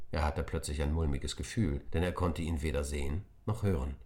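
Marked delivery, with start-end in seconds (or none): none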